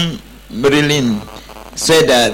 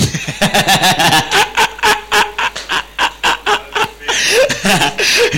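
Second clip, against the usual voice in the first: long, high-pitched laugh; 10 semitones higher than usual. Real, not acted. Preacher amused at a bold job applicant's letter, laughing. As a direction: laugh hard and long at your own story.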